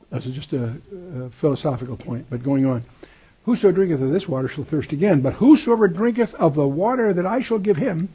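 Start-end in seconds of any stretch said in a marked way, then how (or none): 3.5-8.1 raised, emphatic reading of scripture. Acted. Preacher reads the Bible aloud to his class, raised and emphatic. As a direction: proclaim the verse to the congregation.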